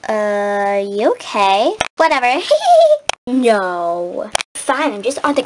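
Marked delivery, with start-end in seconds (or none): 2.4-3.2 mocking laugh. Kaya laughs at her.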